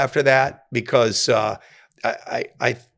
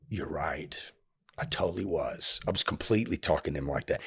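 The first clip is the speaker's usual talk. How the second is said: low, very soft concession; quiet admission; 9 semitones lower, 13 dB quieter than usual. Retold or imitated. Admitting fault to the offended inmate, soft and contrite. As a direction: own up to it in a hushed, lowered voice.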